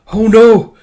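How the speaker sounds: high, loud dramatized dismay; acted horrified exclamation; alarmed cry. Voicing character's dismay.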